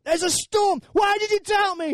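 loud comic storm-shouting; shouting over. Acted. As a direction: call it out above a gale. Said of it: "He's got to shout over".

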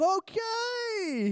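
very high, strained mock-polite reply; comic high-pitched reluctant okay; alarmed going along. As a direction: squeal it uneasily. Startled reply to overeager greeter.

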